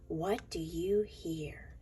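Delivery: hushed storybook whisper. Acted. Reading to kids, whispering the refrain. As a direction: breathe the question softly.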